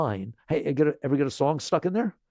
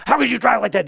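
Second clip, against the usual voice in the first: much higher and louder indignant yell at another driver; mock road-rage outburst; imitating road rage; 11 semitones higher, 9 dB louder than usual. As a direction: blast it like a furious driver behind the wheel. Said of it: "you know, road rage".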